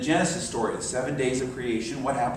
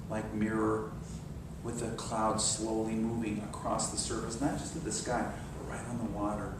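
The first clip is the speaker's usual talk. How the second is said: soft, lower meditation voice; guided meditation; 3 semitones lower, 8 dB quieter than usual. Acted. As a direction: paint the still water gently. Leads a visualization.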